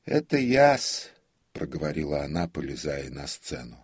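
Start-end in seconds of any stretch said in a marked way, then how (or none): 0.0-1.1 put-on high, loud, slow old man; prompter's voice. A narrator's reading. Prompter announces himself to frightened comic, high voice. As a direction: call out meekly in a high voice.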